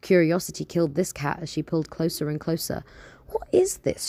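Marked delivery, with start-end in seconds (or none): none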